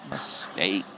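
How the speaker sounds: very low, quiet mock-ominous word. Preacher jokes about marrying a Jezebel, mock-ominous. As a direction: drop it deep and soft for a joke. Joking repeat after Jezebel marriage quip.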